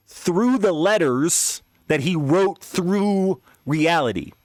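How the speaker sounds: high, slow, drawn-out dramatic awe; mounting disbelief, words dragged out high; freaked-out retelling. Retold or imitated. Freaking out, retelling a hellish vision.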